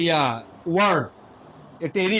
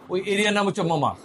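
ordinary talk

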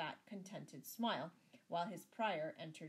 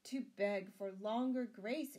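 slow, raised-voice humble plea; 4 semitones higher, 7 dB louder, slower than usual. A narrator's reading. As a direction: beg slowly and humbly. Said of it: "answered Sir Richard humbly".

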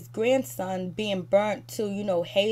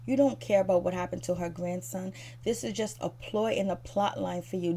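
ordinary delivery

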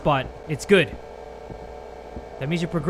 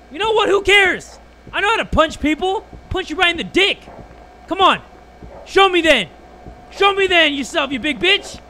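scared bravado; 8 semitones higher than usual. Real, not acted. Streamer taunting the hidden monster out of fear, high shouted bravado. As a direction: shout threats to hide the fear. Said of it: "because I'm so freaking scared".

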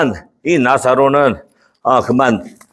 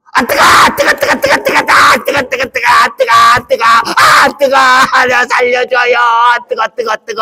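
frantic, much higher yelps of pain; hell burning demo; 12 semitones higher than usual. Shown as a demonstration. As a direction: hop about as if on fire, crying out. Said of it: "예, 잘 봐요". (지옥 불에 데는 흉내를 내며 비명)

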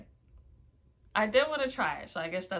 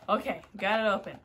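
normal talking voice

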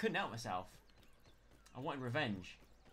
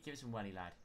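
usual speaking voice

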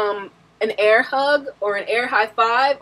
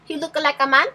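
fake character voice; 3 semitones higher than usual. Playing a made-up character for a guessing game, in a put-on voice. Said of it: "the first two were fake people".

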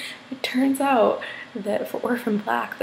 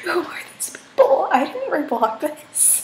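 high-pitched mock bewilderment; baffled reaction; 7 semitones higher than usual. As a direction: act out mild alarm, pitched up. Acts out her baffled Tumblr reaction.

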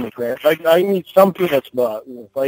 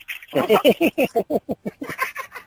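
high cackling witch laugh; 14 semitones higher than usual. Real, not acted. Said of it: "your little witch laugh".

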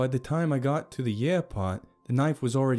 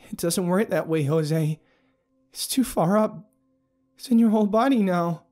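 high, raised, distressed voice; tearful urgency; 9 semitones higher, 5 dB louder than usual. A narrator's reading. Abigail tells the narrator it spread too far, tearfully distressed. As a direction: push it out high, close to tears. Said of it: "tears starting to well up".

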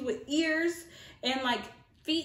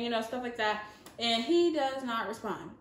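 usual speaking voice

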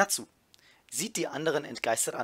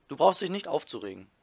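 plain reading voice

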